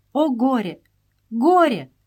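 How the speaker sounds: a hint of sobbing sisters; sobbing lament. A narrator's reading. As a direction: wail over the loss, lightly voiced. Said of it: "рыдали Мария и Эва".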